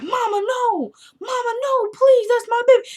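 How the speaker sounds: very high, desperate begging voice; pleading daughter. Retold or imitated. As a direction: plead in a tiny high voice for the baby. Daughter begging mother for baby.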